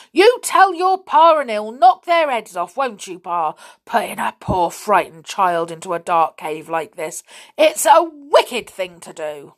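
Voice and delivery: high, loud bursts of outrage; indignant scolding. A narrator's reading. Missus Stick outraged over her son's imprisonment, indignant. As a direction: scold with heavy emphasis. Angry mother over frightened child.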